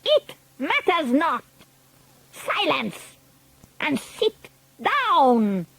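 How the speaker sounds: slow, commanding witch voice; Grand High Witch. A narrator's reading. Grand High Witch silencing the witches, commanding. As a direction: order the room to hush.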